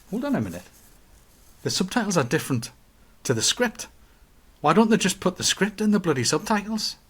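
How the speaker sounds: mock indignation. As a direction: protest the absurdity of it. Retells own indignant reaction to subtitles.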